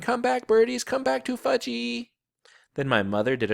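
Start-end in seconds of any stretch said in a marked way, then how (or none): 0.0-2.1 put-on high little-boy cry after the birds; screaming toddler. A narrator's reading. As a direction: plead with the birds like a small child. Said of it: "was screaming".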